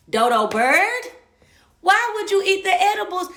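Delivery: high, loud scolding shout; exasperated yell. Retold or imitated. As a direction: scold him like a fool. Scolding boyfriend after collapse.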